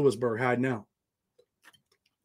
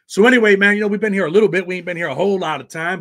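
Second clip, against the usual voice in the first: normal talking voice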